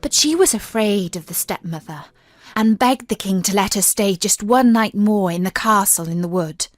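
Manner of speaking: usual speaking voice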